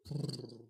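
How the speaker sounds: lips trilled like a dying motor; engine sputter imitation. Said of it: "hear the engine just go".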